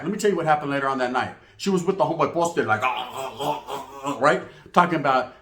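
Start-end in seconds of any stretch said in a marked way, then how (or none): 2.8-4.2 soft imitated moans; mock moaning. Mimicking her posted with another homeboy, mock moaning. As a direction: fake a string of low-key moans.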